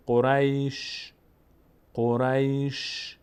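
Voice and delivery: "قریش" drawn out slowly, ending in a bare sh; vowelless final shin. Shown as a demonstration. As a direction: stretch "قریش" and close on a long hiss of ش. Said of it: "شین یک صدایی ازش میاد اما حرکت نداره".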